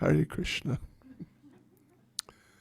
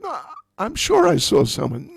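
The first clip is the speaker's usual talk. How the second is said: put-on high, louder insisting old woman; she's sure, pitched up high; insistent old woman; 10 semitones higher, 5 dB louder than usual. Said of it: "And Jatila said".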